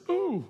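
high-pitched swooping "ooh", falling away; playful, acted cry of delight. Preacher acting God's response to prayer, high "ooh".

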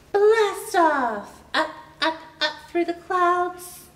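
ordinary delivery, nothing marked

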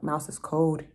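ordinary delivery, nothing marked

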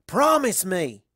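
loud high insistent plea; shouted insistent plea; insistent demand. A narrator's reading. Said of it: "Her insistence".

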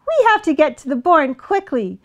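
lightly voiced, high duck; quacking duck. A narrator's reading. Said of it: "Duck quacked".

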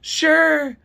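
high, loud mocking one-word reply; high-pitched caricature; Dee's high-pitched voice. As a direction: mimic a squeaky clerk agreeing. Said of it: "She has this high-pitched voice".

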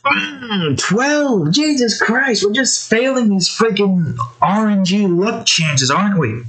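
high, exasperated outburst; real exasperation. Real, not acted. Player cursing the luck chances after another bad roll, exasperated. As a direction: groan it out high in frustration.

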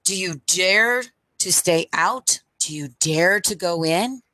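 ordinary delivery, nothing marked

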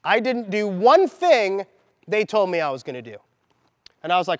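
normal talking voice

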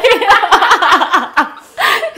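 loud laughing talk. Real, not acted. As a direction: laugh through the words. Laughing while talking.

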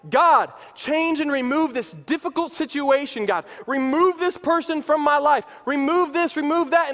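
high, pleading list of demands; acted urgent petition; demanding prayer.

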